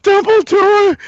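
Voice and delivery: high, loud wailed name; tearful wail. Retold or imitated. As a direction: cry the name out through tears. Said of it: "And she's like".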